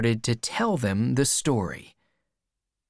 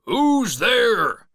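loud, high challenge; calling out; 12 semitones higher, 5 dB louder than usual. A narrator's reading. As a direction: holler to an unknown caller outside. Welshman calling out to stranger.

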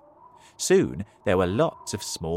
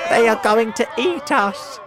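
high, loud wail of alarm; panicked earthworm; 12 semitones higher, 9 dB louder than usual. A narrator's reading. Earthworm tells centipede they'll be eaten, panicked. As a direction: cry out in acted panic.

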